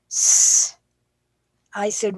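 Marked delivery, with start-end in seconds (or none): 0.1-0.8 whispered letter sound S; child sounding out. Retold or imitated. Boy sounding out the letter S, unvoiced hiss. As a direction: breathe out the S sound. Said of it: "He goes".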